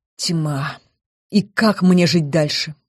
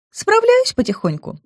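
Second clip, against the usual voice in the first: pixie. A narrator's reading. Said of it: "степенно ответила нимфея".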